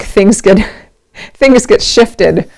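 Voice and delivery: normal talking voice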